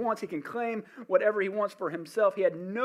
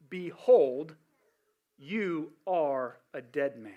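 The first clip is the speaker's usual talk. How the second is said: voice of God; 3 semitones lower, much slower than usual. Acted. God's voice in retold dream.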